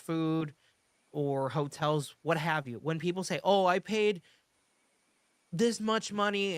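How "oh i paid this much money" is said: imitating an indignant guest, higher pitch; mildly hammed-up customer grievance; mock heated complaint. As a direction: play a guest coming in hot. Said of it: "when they come in hot".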